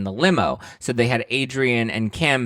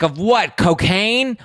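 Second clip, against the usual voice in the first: sassy comeback; 11 semitones higher, 5 dB louder, much slower than usual. Pretend. Speaker's imagined sassy comeback.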